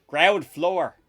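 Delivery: mock Dublin lift announcement; Dublin accent. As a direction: play the installer's Dublin voice. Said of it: "in this Dublin accent".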